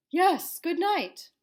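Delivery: loud, slow beast voice; monster voice. Acted. Blue-toed beast answering child in booming voice. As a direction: boom it out like a friendly beast. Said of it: "said the beast".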